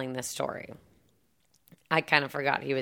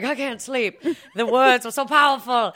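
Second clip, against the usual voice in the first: feverish excitement; 9 semitones higher, 6 dB louder than usual. Retold or imitated. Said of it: "And he's like".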